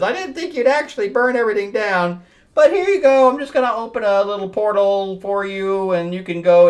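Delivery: high-pitched, hammed-up comic-book villainess; mock villainess voice. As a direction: pitch it up, airily offering a favor. Voicing Satana in a comic.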